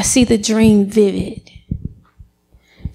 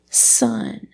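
hushed; 6 dB quieter than usual. Retold or imitated. Mother's gentle first word.

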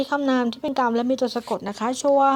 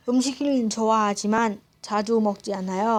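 native speed Korean; much faster than usual. Shown as a demonstration. Reading Korean example sentence.

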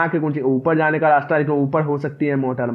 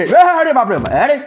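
startled cry; 9 semitones higher, 4 dB louder than usual. Real, not acted. Left alone, startled cry.

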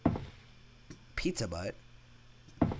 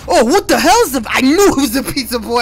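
much higher, much louder terrified yell; scared scream; 12 semitones higher, 16 dB louder than usual. Real, not acted. Gamer jump-scared by the pizza boy, loud high scream.

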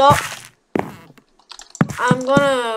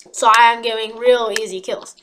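ordinary delivery

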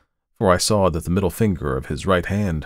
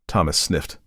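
usual speaking voice

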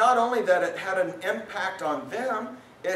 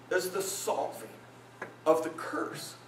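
ordinary delivery

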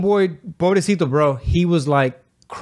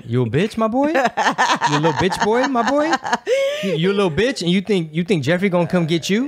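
high, teasing mock taunt; 6 semitones higher than usual. Teasing his partner before a scary documentary, high mocking taunt. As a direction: needle him like a schoolyard bully.

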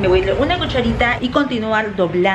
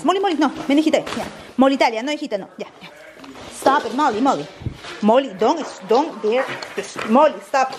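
scolding the dog; 6 semitones higher than usual. Real, not acted.

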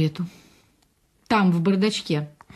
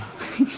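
soft laugh; 3 semitones higher, 6 dB quieter than usual. Acted. Wife laughs at him.

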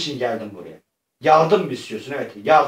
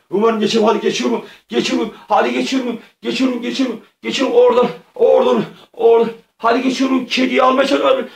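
high-pitched play-acted strain, growing louder; tense effort; 9 semitones higher, 5 dB louder, faster than usual. Player pushing through the beams for the cat, in tense effort. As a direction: urge yourself through, pitched right up, as if mid-effort.

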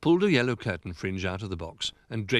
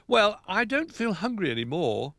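sleepy, higher line through a yawn; 12 semitones higher, 3 dB louder than usual. Acted.

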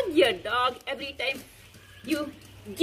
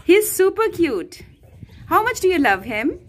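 teasing; 6 semitones higher, 5 dB louder than usual. Real, not acted. Teasing daughter about dog.

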